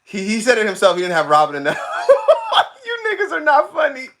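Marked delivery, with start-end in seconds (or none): 1.8-2.8 sudden very high laugh; squealing burst of laughter; real laughing fit. Real, not acted. Cracks up mid sentence. 2.9-4.1 high wail through laughter; tearful laughing squeal; laughing protest. Real, not acted. Denies it while cracking up.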